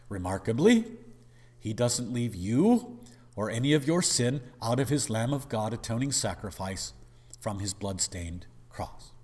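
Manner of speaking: usual speaking voice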